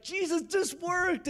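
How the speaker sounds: high-pitched, drawn-out joyful squeal; exploding with joy. Retold or imitated. Student exploding all over her skeptic roommates, joyfully excited. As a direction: imitate a student bursting with joy, slow and very high. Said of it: "she's exploding all over her roommates".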